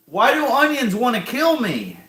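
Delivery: high, anguished complaint; pained wail. Real, not acted. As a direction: wail it at the onions. Complaining through onion burn.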